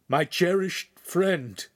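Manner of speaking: slow, high, grief-choked delivery; anguished and halting; struggling to speak. A narrator's reading. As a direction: force it out through grief, drawn out. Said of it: "was able to get out".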